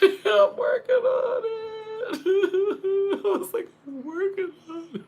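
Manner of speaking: high, crying laughter through the words; laughing. Real, not acted. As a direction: laugh so hard it squeaks. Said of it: "I mean, I'm laughing".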